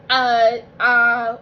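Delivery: halting, voice catching; struggling to get words out; choked up. Real, not acted. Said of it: "I'm kinda getting emotional".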